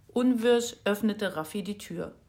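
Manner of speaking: ordinary delivery, nothing marked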